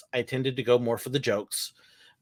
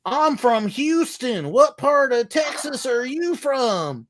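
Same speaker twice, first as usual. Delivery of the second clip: high, slow, broad Texan drawl; Texan accent; 10 semitones higher, 4 dB louder, slower than usual. Retold or imitated. Rider quizzing the skipper about Texas, imitated drawl. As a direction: imitate a Houston man, vowels stretched way out. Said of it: "and goes".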